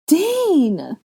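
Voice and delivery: high, loud mimicking wail; exaggerated falling cry; mocking cry. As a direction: parody a desperate call for Dean. Mocking echo of captive's cry.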